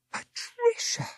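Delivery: high, breathless exclamation of shock; exclaimed. Acted. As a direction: gasp her name in surprise. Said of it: "exclaimed Miss Boston".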